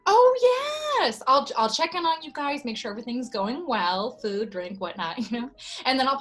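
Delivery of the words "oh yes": high, loud, drawn-out "oh yes"; gushing assurance. Acted. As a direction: pour on the eager waitress enthusiasm. Waitress eagerly confirms she will stay.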